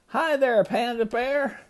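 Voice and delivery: high, sing-out storybook hello; friendly greeting. A narrator's reading. Gator says hello to the panda, high lightly acted greeting.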